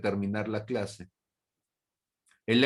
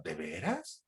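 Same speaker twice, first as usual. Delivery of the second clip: skeptical low aside; 7 semitones lower, 10 dB quieter than usual. Acted. Doubting the fake answer.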